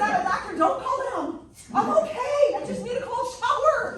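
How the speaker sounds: high, loud, laughing through the words; high laughing retold insistence; laughing protest. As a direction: brush off the fuss with a laugh.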